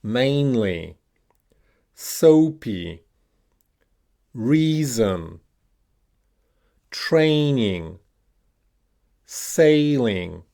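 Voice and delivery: Mainly, soapy, reason, training, sailing with long first syllable, falling second; stress on first syllable. Shown as a demonstration. Teacher giving words to repeat, first syllables punched. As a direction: punch MAIN, SOAP, REA, TRAIN, SAIL, each word alone. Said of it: "stressed on the first syllable".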